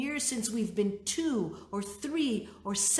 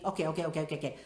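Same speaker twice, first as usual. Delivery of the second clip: soothing okays; 5 semitones lower, much faster than usual. Retold or imitated. Mother calming crying daughter.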